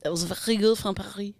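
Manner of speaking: mock French accent; English with French-style throaty r. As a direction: playfully copy the caller's French accent. Echoes caller's French accent.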